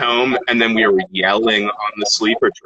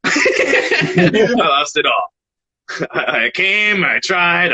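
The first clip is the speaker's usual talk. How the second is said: higher, laughing through the words; 7 semitones higher, much slower than usual. Real, not acted. Rapper telling friend how he lost the contest, laughing. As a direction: laugh while owning up to defeat. Laughing over his own loss.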